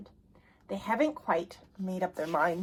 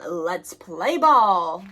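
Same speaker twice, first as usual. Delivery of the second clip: loud, slow call to the group; stretched-out loud call to play; eager call; 3 semitones lower, 7 dB louder, much slower than usual. Acted. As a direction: call out to start the game. Boy calls out to play.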